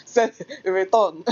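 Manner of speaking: laughing through the words. Real, not acted. Laughing mid sentence.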